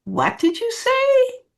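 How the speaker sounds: high, slow, incredulous question; disbelieving. Shown as a demonstration. Coaching a reply to an insult, disbelieving and high. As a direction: sound like you can't believe your ears. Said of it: "use a disbelieving tone of voice".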